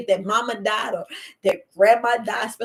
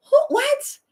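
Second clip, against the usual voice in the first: high, startled "who, what"; sudden high-pitched disbelief; shocked disbelief; 3 dB louder than usual. Retold or imitated. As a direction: jolt up in shock at the news. Hearing grandmother died.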